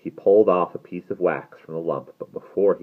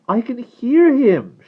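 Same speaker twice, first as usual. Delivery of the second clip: high, loud, drawn-out protest; high, distressed girl's voice; insistent; 11 dB louder, slower than usual. A narrator's reading. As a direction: insist in a high, slow cry. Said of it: "she insisted".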